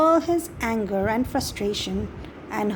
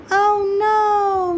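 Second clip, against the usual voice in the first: high, louder alarmed cry; yelled; 9 semitones higher, 5 dB louder than usual. Acted. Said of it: "Mister Mouse yelled".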